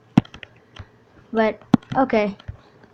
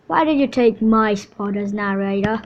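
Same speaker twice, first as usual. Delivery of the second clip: ordinary delivery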